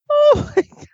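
high, loud laughing cry. Real, not acted. Co-host losing it over the chat exchange, laughing. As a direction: squeal it through laughter.

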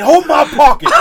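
loud, high mock prison intimidation; hammed-up tough-guy bellow; scared-straight yell. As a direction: shout it like an inmate scaring a kid straight. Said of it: "You needed to scared straight him".

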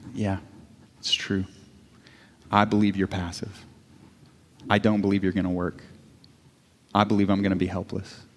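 low, soft, with long pauses; subdued retold confession. Wife confesses her unbelief to God, quietly subdued. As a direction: imitate a hushed, honest prayer.

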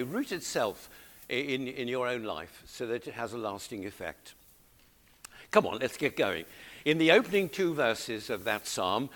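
normal talking voice

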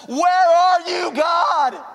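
shouted high and slow, desperate; a drawn-out pleading shout; anguished cry to God. Retold or imitated. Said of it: "the moments where it's like".

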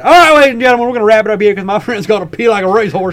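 loud, high, hurried announcer sign-off; rowdy mock show-closing. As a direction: burst in like a hammed-up emcee.

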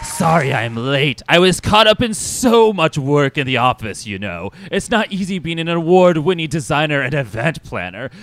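loud, high, grandiose complaining; vain, dramatic diva boss. Acted. Mister Sinister excusing his lateness to everyone, haughty dramatic flourish. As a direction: brag like an overworked celebrity.

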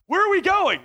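high, eager put-on question; hammed-up curious outburst; mock distracted excitement. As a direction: pipe up as if suddenly sidetracked. Acts himself distracted by someone leaving.

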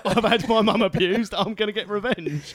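high, loud mock-outraged rant; mock outrage; mock-angry Big Show. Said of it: "He's like".

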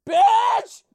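very high, very loud shriek of "Bitch!"; screamed insult. Retold or imitated. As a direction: explode into one shrieked word. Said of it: "I've never heard someone just".

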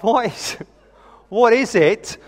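acted parent at a loss, half laughing; exasperated bafflement. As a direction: plead for an explanation, hammed up.

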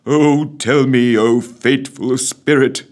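slow, loud solemn address; reverently. A narrator's reading. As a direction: address the spirit with awe. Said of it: "began Scrooge reverently".

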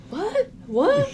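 high, rising fake innocence; feigned confusion. Retelling her trying to play it off terribly, feigned confusion. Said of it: "she kinda pretends like".

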